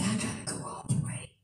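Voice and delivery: soft unvoiced loving whisper; tender whisper. Jesus telling the listeners he goes all the way, whispered. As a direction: breathe it out with love.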